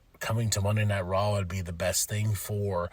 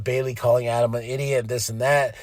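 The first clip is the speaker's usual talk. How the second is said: usual speaking voice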